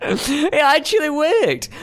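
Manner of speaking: high, drawn-out delighted squeal; delighted amazement. Real, not acted. Player to friend as the trick works, delighted squeal. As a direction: squeal it slowly, high with glee.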